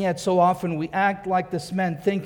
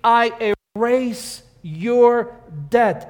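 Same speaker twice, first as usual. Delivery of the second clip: slow declaration; 2 semitones higher, 3 dB louder, much slower than usual. Retold or imitated. God declares debt erased.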